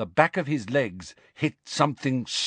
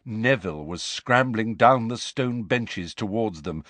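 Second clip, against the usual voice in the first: plain reading voice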